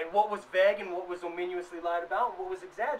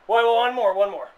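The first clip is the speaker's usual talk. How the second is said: sudden call back; 3 semitones higher, 13 dB louder than usual. Real, not acted. Calling himself back.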